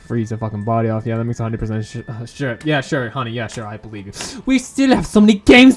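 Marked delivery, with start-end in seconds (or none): none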